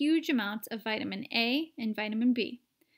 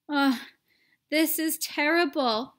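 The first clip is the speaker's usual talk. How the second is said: louder, higher distressed wail; 4 semitones higher, 6 dB louder than usual. A narrator's reading. Mother wailing over her shrunken son, distressed. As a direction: wail in dismay. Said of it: "wailed Missus T V".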